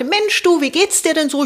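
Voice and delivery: chirpy call to a friend; bright phone greeting. Retold or imitated. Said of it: "rief dann an und meinte". (hoher, lauter fröhlicher Anrufgruß)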